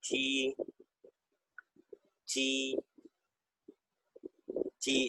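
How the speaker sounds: چی repeated, a soft ch and a narrow ee; Chinese j sound. Shown as a demonstration. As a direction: say چی slowly and clearly for students. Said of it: "تو اس طرح اس کی آواز نکلے گی".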